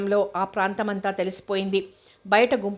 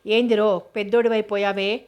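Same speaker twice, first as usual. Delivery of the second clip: plain reading voice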